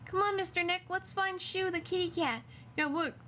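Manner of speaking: high, sing-song talking to a pet; high cutesy voice for the kitty; cute baby-talk voice. Acted. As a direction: coo at the little companion. Talks to game character in cute voice.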